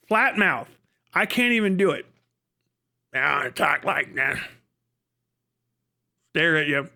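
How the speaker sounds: normal talking voice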